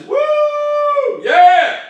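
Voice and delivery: high, loud whoop and yell; celebrating bear. Acted. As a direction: whoop like a bear spotting dinner. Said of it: "The bear says".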